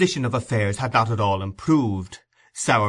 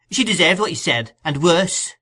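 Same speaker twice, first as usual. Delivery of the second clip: much higher, louder scolding; scolding woman; 7 semitones higher, 5 dB louder than usual. A narrator's reading. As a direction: berate the ungrateful boy. Mrs Sowerberry quarreling.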